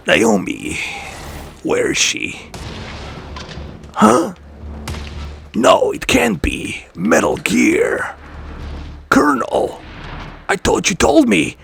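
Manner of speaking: a hint of gravelly Snake. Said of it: "I can do a little bit of, uh, uh, snake voice".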